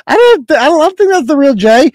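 high, laughter-lifted voice; amused. Real, not acted. Host doubting the donor is real, amused and high-pitched. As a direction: say it just coming out of a laugh. Amused after reading parody donation.

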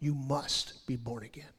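low, soft line with weight on "must"; stress on "must". Shown as a demonstration. Preacher showing how to read the verse, "must" emphasized. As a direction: lean on "must", then ease through the rest. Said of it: "emphasize the word must".

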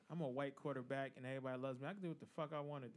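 low, soft mock quarterback voice; Baker Mayfield. Co-host impersonating the quarterback for the panel, low mocking impression. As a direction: imitate him quietly, entitled and offhand. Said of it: "say this in a Baker Mayfield voice".

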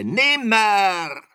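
very high, loud raven screech; piercing raven's cry; croaking raven. A narrator's reading. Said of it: "krächzte da der Rabe".

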